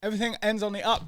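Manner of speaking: lightly done English accent, fast, every phrase ending up; English accent demo. Shown as a demonstration. Cohost showing the English accent with a rising end. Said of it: "when doing a fucking English accent".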